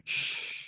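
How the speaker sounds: soft drawn-out shushing sound; long shush. Shown as a demonstration. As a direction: shush like soothing a baby. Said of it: "making a shush sound".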